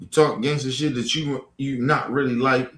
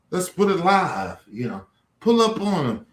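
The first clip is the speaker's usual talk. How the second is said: ordinary delivery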